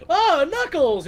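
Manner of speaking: high, bright mock call of his name; hammed-up surprise at seeing a friend; mock excited greeting. Friends greeting Knuckles, high and excited. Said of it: "they're like".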